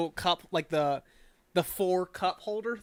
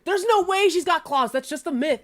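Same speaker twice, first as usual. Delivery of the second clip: insistent argument; 9 semitones higher, 5 dB louder than usual. Acted. Twin arguing back.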